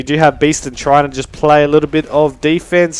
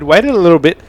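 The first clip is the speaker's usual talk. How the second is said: usual speaking voice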